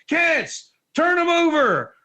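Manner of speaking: imitating a coach calling to the mat; coach yelling. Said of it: "and he's like".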